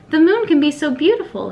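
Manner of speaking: ordinary delivery, nothing marked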